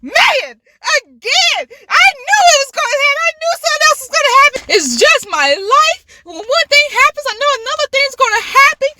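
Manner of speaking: high, loud, fast mock rant; dramatized 'why me' outburst; retold exasperation. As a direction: rail at your own bad luck, pitched right up. Acted despair at dropped hot dog.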